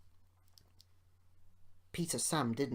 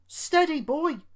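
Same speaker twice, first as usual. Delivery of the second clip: much higher, louder call to steady; urgent warning; 13 semitones higher, 11 dB louder than usual. A narrator's reading. Driver calling Duncan to slow down, urgent shout. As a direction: rein him in fast. Driver trying to check him.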